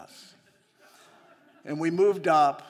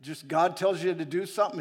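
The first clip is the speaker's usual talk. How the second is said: ordinary talk, nothing marked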